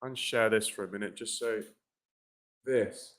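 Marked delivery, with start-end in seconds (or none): 2.6-3.1 "this" with the tongue tip tapping the upper teeth; "this" softly, tongue tapping behind the upper teeth, not sticking out; tapped voiced th. Shown as a demonstration. Teacher showing how to tap the teeth on "this". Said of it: "like this".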